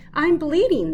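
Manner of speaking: high loud alarmed yelp. A narrator's reading. Fox waking after the extraction, yelping in alarm. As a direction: yelp it out in shock. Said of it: "the fox yelped".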